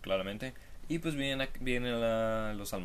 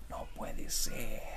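hushed disbelief; much slower than usual. Pretend. Mock reality-show hushed disbelief.

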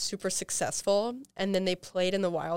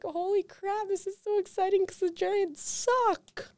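imitated excitement; 13 semitones higher than usual. Reliving the family's Super Bowl moment, high excited squeal. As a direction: squeak it out like a thrilled fan. Quotes own reaction to the win.

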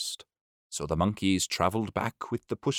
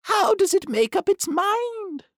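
much higher squawky little duck; high duck voice calling through the door; 4 dB louder than usual. Acted. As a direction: pitch it way up like a fussy duck.

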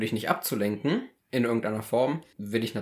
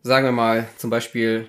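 ordinary talk, nothing marked

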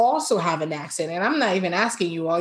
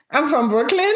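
higher, questioning lilt on the answer; confused reply; 6 semitones higher, 4 dB louder than usual. Retold or imitated. Brooklyn woman answering a question about her origins, confused. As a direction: answer as if unsure what they mean. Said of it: "I'm always confused".

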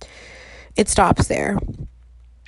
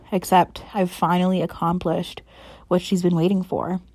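ordinary delivery

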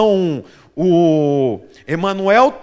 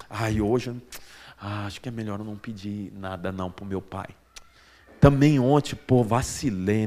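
much lower and softer, a mock guilty kid mumbling; sheepish child; 10 semitones lower, 9 dB quieter than usual. Preacher mimicking a guilty son, lower and softer. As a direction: play the little boy ashamed to ask for anything. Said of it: "Ele não fica pensando assim".

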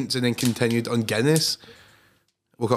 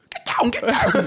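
much higher, mocking Highland Scottish accent; 12 semitones higher, slower than usual. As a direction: imitate the bossy Highland woman in a Highland Scottish accent. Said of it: "And a stupid Highland accent".

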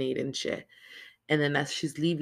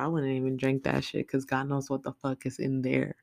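ordinary delivery

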